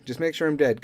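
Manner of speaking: ordinary delivery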